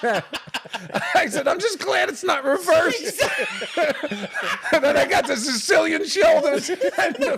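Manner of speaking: talking through laughter; laughing. Real, not acted. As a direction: laugh while telling the punchline.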